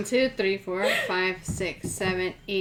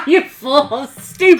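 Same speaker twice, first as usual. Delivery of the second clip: loud, high yell through laughter; gleeful yell; 4 semitones higher, 10 dB louder than usual. Acted. Caster taunts the party while throwing the spell, laughing yell. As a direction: yell it at them, cackling. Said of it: "And then I literally yell".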